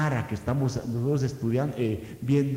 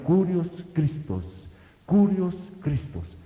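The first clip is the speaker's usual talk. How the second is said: each word drawn out and weighty; solemn slow confession of faith; slow proclamation; 3 semitones higher, slower than usual. Retold or imitated. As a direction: proclaim Curios Christos slowly. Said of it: "y decían".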